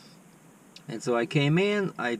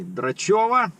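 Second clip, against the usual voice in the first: higher, louder official nurse calling a name; pretend nurse; 7 semitones higher, 5 dB louder, slower than usual. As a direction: call out the surname like a hospital nurse. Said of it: "kinda like as if I'm a nurse".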